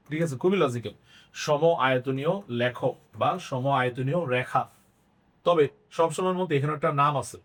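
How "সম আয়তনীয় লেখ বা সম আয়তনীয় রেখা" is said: সম আয়তনীয় লেখ, every word drawn out slowly; slow dictation. Shown as a demonstration. Teacher dictating the term to students, slow and deliberate. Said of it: "মনে রাখবা".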